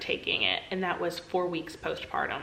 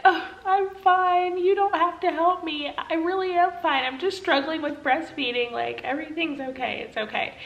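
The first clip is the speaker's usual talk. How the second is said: through tears; 8 semitones higher, 3 dB louder than usual. Retold or imitated. New mother tearfully telling nurse she's fine, crying. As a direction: choke out a tearful "I'm fine" through the sobs. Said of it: "And through my tears".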